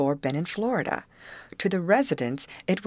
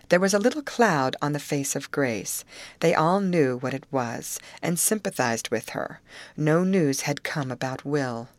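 ordinary delivery

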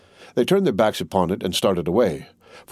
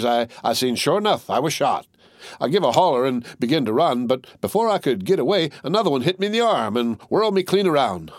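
chatty tattered soldier; 5 semitones higher than usual. Acted. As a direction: spin the yarn of how he got shot. Tattered soldier tells his story.

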